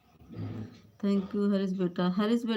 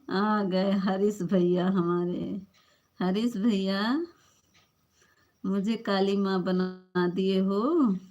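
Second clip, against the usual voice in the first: ordinary talk, nothing marked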